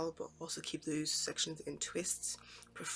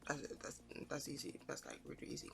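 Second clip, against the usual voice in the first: imagined viewer's reaction; 2 semitones lower, 10 dB quieter than usual. Acted. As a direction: voice a knowing viewer quietly brushing it off. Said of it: "will kind of be like".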